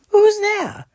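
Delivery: very high, loud startled squeak; a frightened mouse calling into the dark; tiny mouse crying out at glowing eyes; mouse cries out. Acted. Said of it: "Mouse cries".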